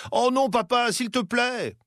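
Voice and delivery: put-on lamenting child. A narrator's reading. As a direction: beg to stay up. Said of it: "se lamente Charlet".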